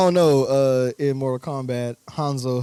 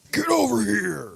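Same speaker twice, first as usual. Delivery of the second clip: high, drawn-out battle cry; Scorpion; 5 semitones higher, slower than usual. Acted. Podcaster quoting a fighting-game character, mock battle cry. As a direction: call it out like a fighting-game catchphrase. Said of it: "'Cause Scorpion doesn't Scorpion saying".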